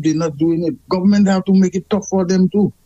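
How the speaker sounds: usual speaking voice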